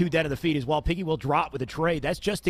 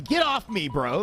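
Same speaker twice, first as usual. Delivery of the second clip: put-on Valley Girl accent; 3 semitones higher than usual. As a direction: toss off a California Valley Girl line. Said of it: "that sounded like a California".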